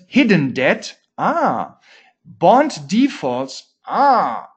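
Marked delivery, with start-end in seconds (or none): 1.2-1.8 mock realization. Mock revelation at officials' obvious warnings. 3.9-4.5 mock realization. Mock revelation at officials' obvious warnings.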